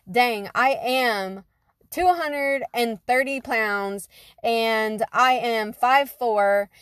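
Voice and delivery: drawn-out, slow words of a hard truth sinking in; slow stunned realization. Retold or imitated. To herself, realizing her weight, slow and stunned. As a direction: let each number land slowly.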